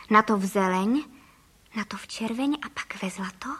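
ordinary delivery

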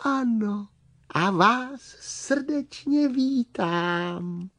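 high, slow tiny creature; piping little worm host. Acted. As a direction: welcome them in a small, high, drawn-out voice. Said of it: "ozval se tichý hlásek".

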